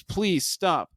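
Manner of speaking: high, mildly pleading retelling; understated desperate plea; begging. Retold or imitated.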